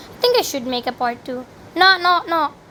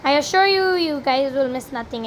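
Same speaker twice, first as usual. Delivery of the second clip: usual speaking voice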